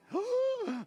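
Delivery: high, sharp pretend gasp; mock gasp. Pastor pretending shock at his helper pulling her own ticket, mock gasp.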